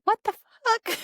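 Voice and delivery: sudden high shocked squeak; mock a kid's stunned disbelief; shocked outburst. Teen son hearing they move in three days, high shocked squeal. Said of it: "is like".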